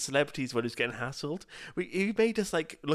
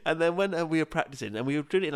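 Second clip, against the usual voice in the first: ordinary talk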